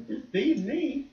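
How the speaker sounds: high, slow, soft little plea; lightly voiced child wheedling softly; pleading child. Little girl coaxing her dad, pleading.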